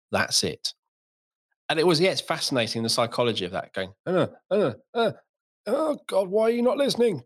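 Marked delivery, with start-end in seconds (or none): 4.1-7.2 higher-pitched exasperated pleading; mock exasperation. Father failing to get daughter's attention, mock exasperated. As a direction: act out a fed-up parent, pitched high. Acting out failed attempts at attention.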